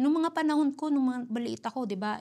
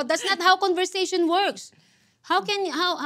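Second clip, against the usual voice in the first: higher, slower, pointed, imitating her youngest son; child's objection, emphasized; 6 semitones higher, 5 dB louder, slower than usual. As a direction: lean on every word like a child scoring a point.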